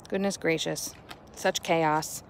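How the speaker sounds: ordinary delivery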